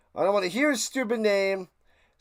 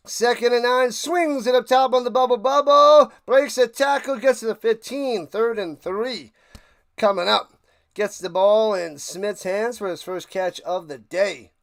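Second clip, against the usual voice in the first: louder excited commentary, "bubble" shouted; play-by-play call; 3 semitones higher, 5 dB louder than usual. Acted. Calling the drive for his viewers, excited play-by-play. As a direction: narrate the drive like a broadcaster. Calls the game live.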